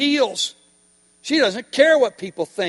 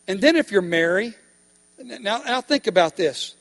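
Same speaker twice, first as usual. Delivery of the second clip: ordinary talk, nothing marked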